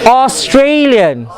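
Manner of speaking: slow "Australian" with long TRAY; "Australian" said as "aw-STRAY-lian"; stressed syllable. Shown as a demonstration. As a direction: lean hard on the middle of "Australian". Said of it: "it's all about the stress".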